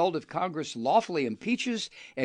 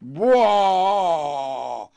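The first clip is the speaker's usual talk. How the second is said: loud, slow blustering voice; puffed-up bully; 8 dB louder, much slower than usual. Retold or imitated. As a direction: boom it out like a bully. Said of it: "Trump all puffed up".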